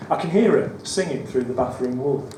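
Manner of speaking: ordinary delivery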